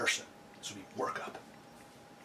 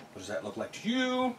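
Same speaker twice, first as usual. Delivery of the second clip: high insinuating question; 13 semitones higher than usual. Real, not acted. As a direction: rise high with a knowing tease. Suggestive pink accessory joke.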